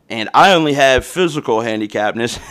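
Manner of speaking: usual speaking voice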